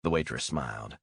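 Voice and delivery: ordinary talk, nothing marked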